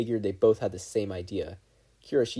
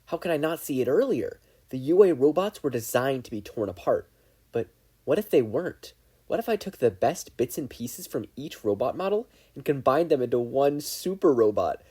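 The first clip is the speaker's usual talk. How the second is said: rising excited villain monologue, louder toward the end; scheming villain; 6 semitones higher, 7 dB louder than usual. Acted. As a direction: gloat your way into a triumphant scheme.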